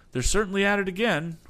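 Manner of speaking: high, loud eager call; excited cry. Acted. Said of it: "cried Tom".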